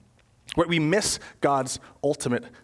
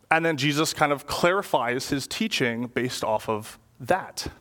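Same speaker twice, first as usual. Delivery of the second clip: ordinary delivery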